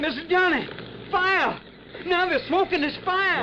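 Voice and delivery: yelling in terror as smoke fills the room; panicked cry. Acted. Trapped in a burning shack.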